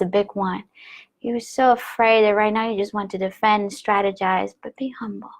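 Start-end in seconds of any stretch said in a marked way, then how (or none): 4.6-5.3 soft whispered be humble. Pretend. Repeating intuition's advice in a whisper. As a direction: hush it like inner advice.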